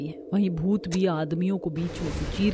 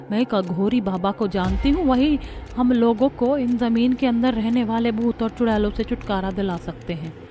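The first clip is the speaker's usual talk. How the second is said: ordinary talk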